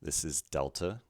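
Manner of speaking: ordinary talk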